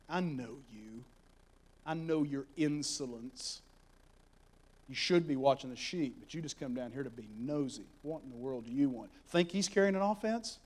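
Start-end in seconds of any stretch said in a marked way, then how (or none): 0.1-9.1 a hint of a sneering older brother; scornful older brother. Eliab chastises David in story.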